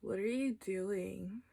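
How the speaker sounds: slow, quiet, baffled question; baffled eyes. Acted. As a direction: ask it softly and slowly, puzzled. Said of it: "my eyes looked back at me".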